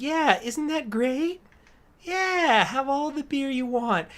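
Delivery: put-on very high, loud, wheedling child voice; high, pushy little-girl sales pitch about beer. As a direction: coax him toward the van like a sweet little girl. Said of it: "and he's like".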